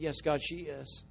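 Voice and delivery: soft, slow and choked with tears; tearful reply. Real, not acted. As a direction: say it quietly through real tears. Crying with mom at parting.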